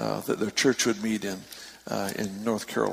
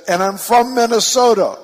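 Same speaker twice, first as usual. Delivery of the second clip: much higher, very loud call; yelling; 10 semitones higher, 11 dB louder than usual. Retold or imitated. Lady adds her home state to preacher, yelling. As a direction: shout it proudly to the far end. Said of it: "she stands up and she yells".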